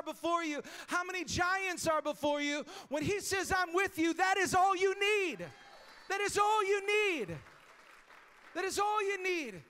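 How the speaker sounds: rising to a loud, high preaching cry; preaching shout. Real, not acted. Preacher to the congregation, full-force shouted climax. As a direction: hammer it home to the congregation.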